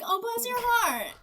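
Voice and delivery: very high, slow cooing sympathy; mock doting principal. Principal consoling Lucas, mock tender. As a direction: gush with exaggerated pity.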